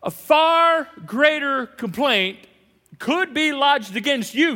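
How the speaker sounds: slow, loud and high proclamation; drawn-out booming warning. Acted. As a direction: thunder it out slowly like a warning. Preacher booming warning to congregation.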